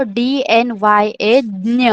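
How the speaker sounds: slowly name डी, एन, वाई, ए, then ज्ञ; letter by letter, ending on a blended ज्ञ; spelled-out pronunciation. Shown as a demonstration. Teacher showing pupils how to pronounce ज्ञ, spelled out slowly. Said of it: "ज्ञ कैसे pronounce करना है".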